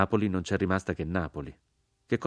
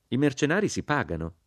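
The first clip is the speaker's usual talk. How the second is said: a stern, higher rebuke; 7 semitones higher than usual. A narrator's reading. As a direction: say it severely, like a reproach. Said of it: "con voce severa".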